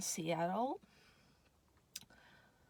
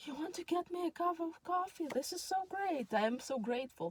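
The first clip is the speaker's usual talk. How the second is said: eager thanks; 9 semitones higher than usual. Retold or imitated. Her eager reply to the guy.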